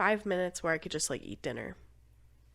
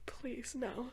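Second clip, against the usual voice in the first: quiet plea; 3 semitones higher, 14 dB quieter than usual. Retold or imitated. Begging reality not be true.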